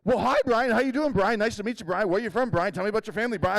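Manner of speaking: imitating the wife who keeps saying Brian; chatty wife. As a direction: gush it like an overeager hostess. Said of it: "and she's like".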